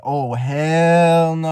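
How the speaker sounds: slow, stretched retold reaction; drawn-out dismay. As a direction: drag out each word in mock dread. Retold reaction before running out.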